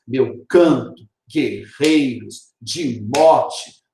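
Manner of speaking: "Meu canto, guerreiros, de morte" in separated beats, weight on CAN, REI, MOR; marked stressed syllables. Shown as a demonstration. Teacher showing students the tonic syllables, "Meu canto, guerreiros, de morte" in stressed, separated beats. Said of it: "na marcação das tonicidades".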